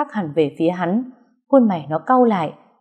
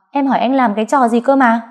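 higher, louder sharp demand; shrill demand; 5 semitones higher, 4 dB louder than usual. Acted. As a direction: snap the question high. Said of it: "Cái giọng thè thé của nó".

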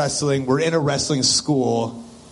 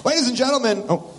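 booming ring announcer intro; wrestling promo; 7 semitones higher than usual. Pretend. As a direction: hype the crowd like an announcer. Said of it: "I'll be the intro guy".

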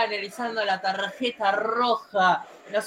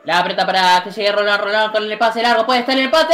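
excited play-by-play; 5 dB louder, faster than usual. Acted. Live play-by-play build-up.

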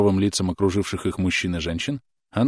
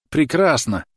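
Прекрасно shouted higher and louder; higher exclaiming; 8 semitones higher, 5 dB louder, slower than usual. A narrator's reading. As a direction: lift Прекрасно up in joy. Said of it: "восклицал он".

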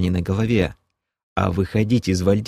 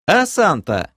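higher, louder teasing retort; teasing jab; 10 semitones higher, 3 dB louder, slower than usual. Acted. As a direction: needle him playfully. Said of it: "подколол его Док".

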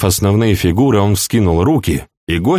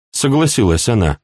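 ordinary delivery

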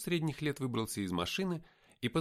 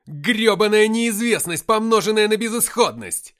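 high, full-force yell of frustration; exasperated shout; 10 semitones higher, 16 dB louder than usual. A narrator's reading. Narrator at breaking point yelling at heaven, exasperated shout. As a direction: shout it to the sky, fed up. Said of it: "крикнул я".

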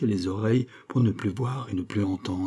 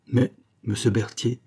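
usual speaking voice

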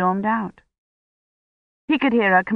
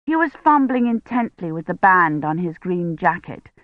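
usual speaking voice

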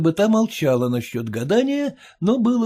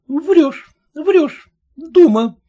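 high, loud accusing cry; angry interruption; 11 semitones higher, 5 dB louder, slower than usual. A narrator's reading. Daughter cutting off the peddler, loud and furious. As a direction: cut her off in a rage. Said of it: "перебила её Елена Герасимовна".

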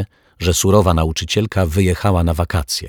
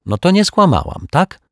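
high, cheeky snort of a comeback; cheeky little sister scoring a point; snorting retort; 13 semitones higher, 4 dB louder than usual. A narrator's reading. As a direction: toss it back smugly, pitched up. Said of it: "Prychnęła Zuzia".

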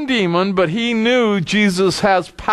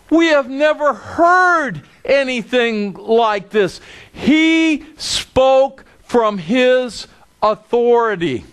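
ordinary talk, nothing marked

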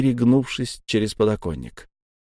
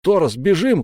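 higher, urgent cry to flee; frightened shout; 7 semitones higher, 4 dB louder than usual. A narrator's reading. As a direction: call out to a comrade in fright. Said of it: "крикнул я в испуге".

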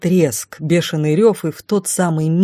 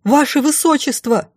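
high-pitched, anxious call; raised searching cry; 9 semitones higher than usual. A narrator's reading. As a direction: hail the missing prince urgently. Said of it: "снова крикнула".

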